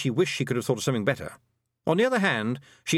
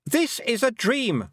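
high, slow shout; boy shouting; 13 semitones higher, 3 dB louder, slower than usual. A narrator's reading. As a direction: shout each word after her. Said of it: "Roland shouted".

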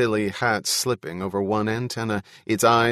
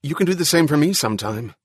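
quick, louder and pitched up; rapid voice; 6 semitones higher, 5 dB louder, faster than usual. A narrator's reading. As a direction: rush it out fast and high. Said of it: "In a rapid voice".

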